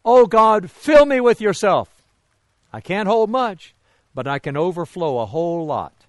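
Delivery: lightly voiced child's plea, starting high and loud; little girl praying. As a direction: imitate a girl's eager prayer, easing off. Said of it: "One little girl said to God".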